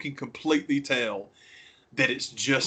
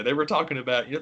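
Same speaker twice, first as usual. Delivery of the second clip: ordinary delivery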